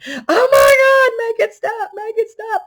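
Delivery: high, loud, terrified crying shout; panicked screaming. Retold or imitated. On a bucking horse, begging friends to stop it: terrified crying scream. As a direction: shriek it out like you're about to be thrown off. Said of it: "and I'm screaming".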